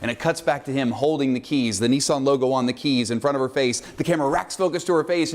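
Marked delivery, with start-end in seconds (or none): none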